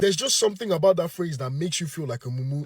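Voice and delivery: ordinary talk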